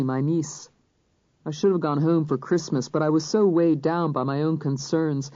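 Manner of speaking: ordinary delivery, nothing marked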